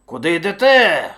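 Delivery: booming interrogation; challenging bear shout. A narrator's reading. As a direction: stop travellers as a bossy bear. Rival bear challenges the hares.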